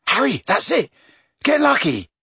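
high-pitched eureka burst; sudden excitement. A narrator's reading. Boy tells friend his sudden idea, excitedly. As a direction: urge it on your friend, thrilled. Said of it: "said Ron suddenly".

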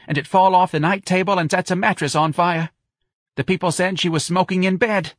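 high-pitched, lively New Orleans gossip; aggrieved old local woman. Acted. Woman telling friend of slandered relatives, indignant.

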